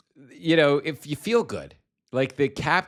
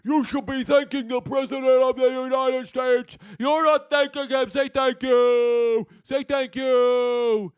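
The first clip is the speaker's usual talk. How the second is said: high, whiny put-on voice, slow on the last demands; squeaky scold demanding gratitude; mocking J D Vance; 12 semitones higher, 4 dB louder than usual. Mimicking Vance scolding Zelensky, high whiny put-on voice. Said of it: "J D Putz told Zelensky".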